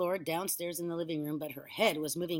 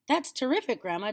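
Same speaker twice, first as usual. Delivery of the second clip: higher, louder delighted exclamation; excited cry; 7 semitones higher, 6 dB louder than usual. A narrator's reading. Boy thrilled grandma is on her feet, excited cry. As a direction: cheer her on. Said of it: "George cried".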